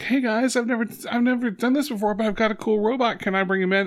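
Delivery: put-on high, fast, eager kid. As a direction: play the excited young boy. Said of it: "he's like".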